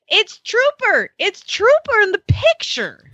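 put-on a small boy pointing at the sign; excited little boy. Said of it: "he goes".

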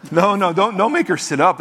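ordinary delivery

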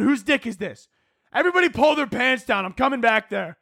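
high-pitched, indignant mock outrage; overdramatic pilot rant. Pilot interrogating passengers over the mic, mock outrage.

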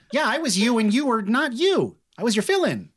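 high, excited chatter; bubbly and pleased with herself; eager halfling. Acted. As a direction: explain it brightly, pitched well up. Halfling explains to her idol.

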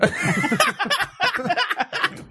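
big high-pitched burst of laughter; real laughter bursting out. Real, not acted. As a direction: crack up at the joke. Laughs at the Frodo joke.

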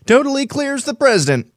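high mocking singsong; mock the slogan sarcastically; sarcastic. Sarcastic repeat of headline.

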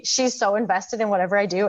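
normal talking voice

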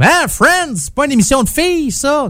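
high-pitched mock disbelief; mocking scoffing guys. Host imitating guys who dismiss his favourite show, mock-incredulous. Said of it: "il y a bien des gars qui font comme".

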